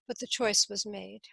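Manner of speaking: ordinary delivery, nothing marked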